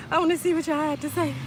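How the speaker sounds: giggling high through the words; high laughing voice. Real, not acted. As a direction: laugh while reading the comments. Laughing while reading comments.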